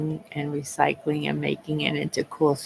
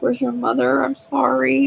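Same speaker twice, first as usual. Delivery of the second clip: higher, sorry little voice to an animal; pitying the bunny she ran over; talking to the hurt bunny; 7 semitones higher, 5 dB louder than usual. Retold or imitated. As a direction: coo an apology to a dying rabbit. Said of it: "Oh, I was talking to him".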